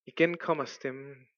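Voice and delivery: ordinary talk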